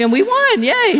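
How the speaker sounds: high and loud little cheer; cheering yay. Real, not acted. Telling the congregation they won, high happy cheer. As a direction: celebrate the victory. Celebrating the win.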